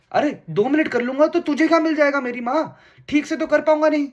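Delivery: high-pitched exasperated retort, rising in pitch; fed-up boyfriend losing patience; exasperated outburst. Acted. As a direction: plead and protest at the top of your range. Acted retort to a nagging girlfriend.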